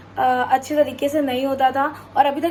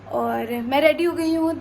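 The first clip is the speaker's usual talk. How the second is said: ordinary delivery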